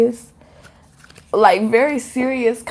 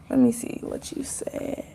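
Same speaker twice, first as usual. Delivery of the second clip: whispering; 9 dB quieter than usual. Real, not acted. Reads viewer comments aside.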